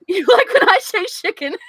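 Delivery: giggly mock flirt, high and loud; laughing tease. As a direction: tease the chat through laughter. Teasing a chat comment.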